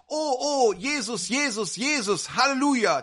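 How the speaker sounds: ecstatic praise. Retold or imitated. Healed woman rejoices aloud.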